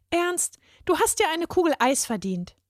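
put-on high, eager small-girl voice; a little girl giving big advice; earnest little girl. A narrator's reading. Girl explains to the boy why he should lighten up, high and eager.

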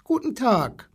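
high, lightly voiced child; a small boy's high-pitched hello; little boy greeting. A narrator's reading. Small boy greets zoo animals, high child voice.

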